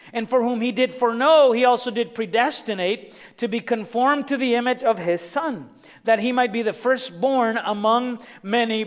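rising, forceful sermon delivery; preaching. Acted. Preacher exhorts the congregation, building to forceful proclamation. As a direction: drive each point home to the congregation.

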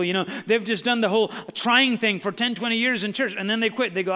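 loud, high, rising preacher's cry; full-force preaching. Acted. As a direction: preach it hard, building to a shout.

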